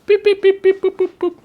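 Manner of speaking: high-pitched robot beeping; robot beeps. Pretend. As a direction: beep like a little robot. Robot beeping noises on stream.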